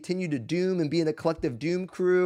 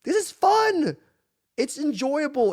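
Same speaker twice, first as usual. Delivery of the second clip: emphatic; 7 semitones higher, slower than usual. Real, not acted. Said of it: "insisting on the fun".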